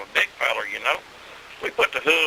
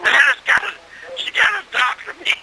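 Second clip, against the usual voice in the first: pleading holler; 13 semitones higher than usual. Retold or imitated. Stung Billy begging the boys to take him to his wife. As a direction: beg to be taken home, shouting. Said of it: "He said".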